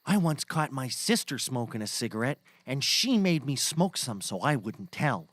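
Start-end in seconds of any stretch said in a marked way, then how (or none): none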